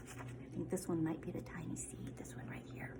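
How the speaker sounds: soft whispered pointing; whispered aside. Real, not acted. Teacher showing the class which plant, in a whisper. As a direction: hush it as you point.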